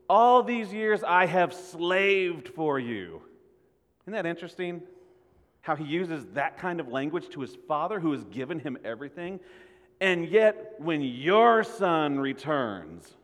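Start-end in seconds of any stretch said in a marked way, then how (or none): 0.1-3.2 slow, louder and higher, every word weighed; bitter complaint. Acted. Older son reproaching his father for years of slaving, bitter. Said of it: "he said it like this". 10.0-13.1 slow, louder and higher resentment; bitter complaint. Acted. Older son complaining about his brother's homecoming, bitter. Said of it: "he said it like this".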